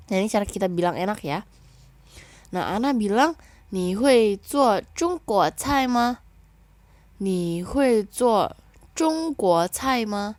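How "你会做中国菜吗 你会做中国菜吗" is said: very slow, higher and louder Mandarin question; slow model reading. Shown as a demonstration. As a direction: read it out clearly to the class. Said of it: "Kita baca ya".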